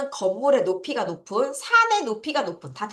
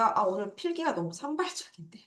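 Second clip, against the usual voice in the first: unvoiced self-comment; teacher muttering about messy notes; whispered aside; 9 dB quieter than usual. Real, not acted. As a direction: whisper it to yourself.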